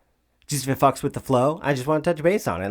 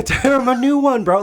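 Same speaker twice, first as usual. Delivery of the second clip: mock yell, much higher and louder; Tanaka yelling; 12 semitones higher, 7 dB louder than usual. Host echoes Tanaka's serve heckle in a higher, louder mock yell. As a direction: hype it up like a rowdy sideline cheer. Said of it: "Tanaka yells something like that".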